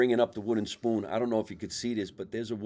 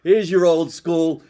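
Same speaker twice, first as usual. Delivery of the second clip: calling out; 8 semitones higher, 11 dB louder, slower than usual. Real, not acted. Handing over cigars, calling out.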